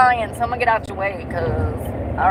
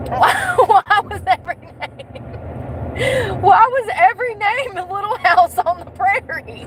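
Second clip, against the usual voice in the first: much higher, slow disbelief at the start; incredulous high question; 9 semitones higher than usual. Real, not acted. Asking viewers about the odd restaurant names, incredulous. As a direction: pitch the outrage high and draw it out.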